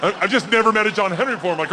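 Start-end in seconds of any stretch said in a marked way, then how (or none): none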